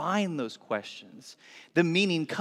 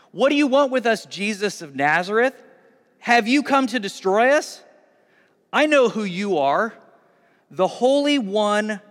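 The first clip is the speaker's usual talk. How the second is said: possessed man's raised, higher cry; 5 semitones higher, 6 dB louder than usual. A narrator's reading. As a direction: cry it out loudly, pitched up. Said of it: "possessed by an impure spirit cried out".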